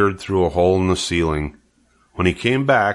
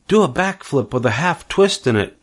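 crying out; 6 semitones higher than usual. Acted. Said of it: "cried Charlotte".

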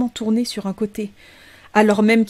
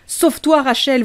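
high, raised shout of warning; urgent cry; 5 semitones higher, 4 dB louder than usual. A narrator's reading. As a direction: cry out to send a daughter fleeing. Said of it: "voulut-elle crier à sa fille".

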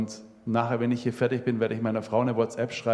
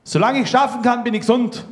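defiant shout; 13 semitones higher, 9 dB louder than usual. Retold or imitated. Old man hears of his cancer, shouts in defiance. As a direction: yell it out as a stubborn old man refusing his diagnosis. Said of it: "da hat er geschrien".

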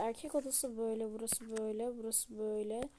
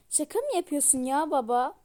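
loud, incredulous protest to dad; outraged disbelief; 3 semitones higher, 9 dB louder than usual. Acted. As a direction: protest loudly as if you can't believe it. Daughter protests engagement announcement. (öfkeli, inanamayan yüksek sesli itiraz)

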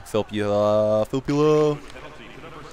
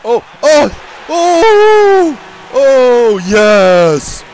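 much higher, louder cheers; real excited cheering; 11 semitones higher, 10 dB louder, much slower than usual. Real, not acted. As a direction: erupt as the puck goes in. Team scores, cheering.

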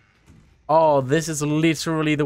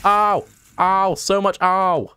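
just got slapped; 6 semitones higher, 3 dB louder, slower than usual. Real, not acted. Wasps hitting him in the face.

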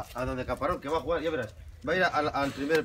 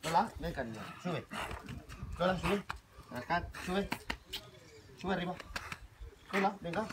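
soft coaxing; 4 semitones higher, 8 dB quieter than usual. Real, not acted. Coaxing dog to come up.

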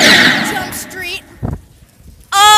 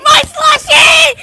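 high, loud shout; 7 semitones higher, 4 dB louder than usual. Real, not acted. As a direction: yell for the drink. Grabbing for his slushie.

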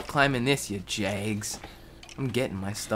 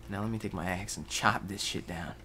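ordinary talk, nothing marked

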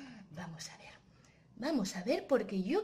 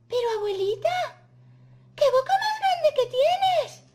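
put-on very high, loud girlish amazement; astonished Caperucita; 10 dB louder than usual. Caperucita noticing grandma's huge mouth, astonished. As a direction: build to a high, loud exclamation.

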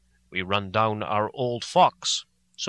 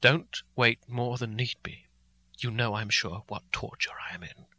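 softer, sinking to a whisper on the last words; said quietly; 3 semitones lower, 6 dB quieter than usual. Acted. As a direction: hold back, low and pained. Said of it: "So I said as quietly as I could".

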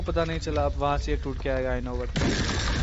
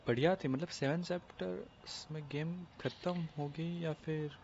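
much softer and hushed, winding down; soft reflective; 9 dB quieter than usual. Real, not acted. Gamer musing over the peaceful ending, quietly.

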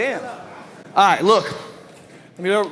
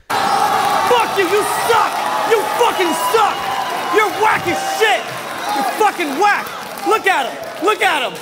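loud, high-pitched furious yelling; yelling insults; 13 semitones higher, 3 dB louder than usual. Acted. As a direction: scream it in his face. Battle rap, yelling insults.

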